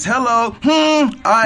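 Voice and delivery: showman announcer yell; hype shout. Acted. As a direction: announce yourself like a wrestler. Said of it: "My name is [name]".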